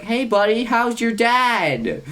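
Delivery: high, drawn-out teasing hello; mock-cheery greeting. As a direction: greet your pal in a high, stretched singsong. Mock-cheery greeting to fatherless friend.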